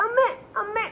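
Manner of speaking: lightly imitated toddler calling amme, pitched high; a hint of a little girl calling for her mother; mother imitating her toddler calling out; child calling mum. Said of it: "എന്ന് പറഞ്ഞിട്ട് വിളിക്കും".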